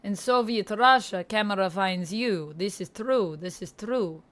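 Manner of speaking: put-on Russian accent. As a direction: speak English with your best Russian accent. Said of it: "doing my best Russian dialect".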